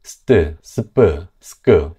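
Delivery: 스트 스프 스크 said slowly with tense, fortis consonants; tense consonant clusters. Shown as a demonstration. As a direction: say 스트 스프 스크 as hard tensed sounds. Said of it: "된소리에 훨씬 가까워요". (된소리로 천천히 스트 스프 스크)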